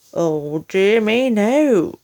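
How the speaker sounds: loud hammed-up dismay; mock dismay. Husband fakes dismay to wife, loud hammed-up. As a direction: ham up the horror.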